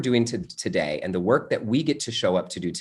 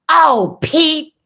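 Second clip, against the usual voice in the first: aunt scolding; 8 dB louder than usual. Retold or imitated. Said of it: "she goes".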